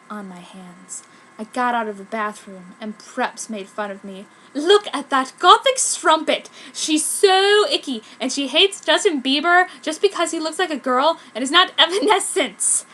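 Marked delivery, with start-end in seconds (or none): 4.5-12.8 higher, louder sneering prep; mocking prep. Prep jeering at the goth girl, higher and louder. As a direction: jeer it at her in the hallway.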